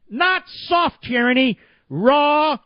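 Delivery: ordinary delivery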